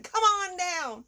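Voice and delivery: hammed-up car lot ad voice; used car salesman. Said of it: "I sound like a used car salesman".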